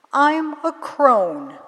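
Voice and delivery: put-on drawn out like an old woman; slow crone voice.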